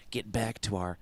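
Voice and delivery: breathed, voiceless catchphrase; mock-dramatic whisper. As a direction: whisper it like a show's tagline.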